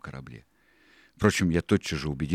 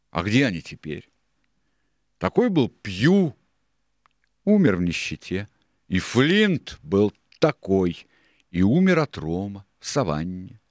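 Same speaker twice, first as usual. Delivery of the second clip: louder, higher rhetorical retort; Silver, pointed; 4 semitones higher, 7 dB louder than usual. A narrator's reading. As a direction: drive home each dead man's fate. Silver retorts about dead pirates.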